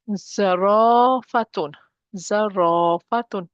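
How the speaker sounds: zarafatun with a long held "raa"; stretched long vowel. Shown as a demonstration. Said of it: "There's a mud here, stretching".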